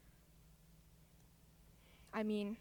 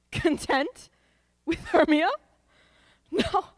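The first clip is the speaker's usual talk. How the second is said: scoffing disbelief; 5 semitones higher, 6 dB louder than usual. Acted. Lysander scoffs at Helena's words.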